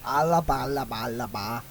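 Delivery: half-joking magic words; mock incantation. Mocking his friend's spell talk with a mild mock incantation. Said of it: "I'm not reciting an incantation".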